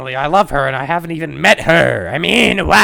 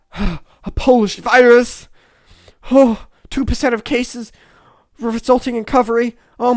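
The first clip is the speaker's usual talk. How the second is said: high, loud breathless gushing with gasps; hammed-up swoon; flustered swooning; 6 semitones higher, 6 dB louder than usual. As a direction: gush like a starstruck admirer, overcome and flustered. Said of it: "This person is making me flustered".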